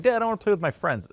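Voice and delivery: put-on high, fast childish plea; whiny kid. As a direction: whine like a ten-year-old begging dad. Kid whining to dad.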